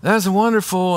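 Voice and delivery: high forced "oh" then strained congratulations; congratulating the pregnant friend through a forced smile; fake happiness. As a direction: mask your hurt with a bright, high reply. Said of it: "And then you go into the kitchen".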